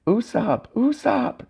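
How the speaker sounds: high falsetto cry at held-back volume; high, contained panicked wail; mock screaming. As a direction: call the name in a restrained shriek. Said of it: "And they're screaming".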